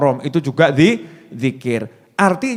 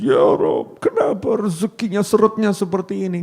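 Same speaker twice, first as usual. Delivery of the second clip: mildly tearful wife complaining in prayer; mock crying prayer; 2 semitones higher than usual. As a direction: whimper to God about the money.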